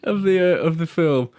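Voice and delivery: high voice breaking up with laughter; helpless laughter. Real, not acted. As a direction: struggle to get the words out through giggles. Cracking up at own joke.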